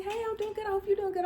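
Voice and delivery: high, sugary polite answer; imitating friend's sweet reply. The friend replying to her in a high, sweet voice. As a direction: lift the voice way up, all sweetness. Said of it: "She's like".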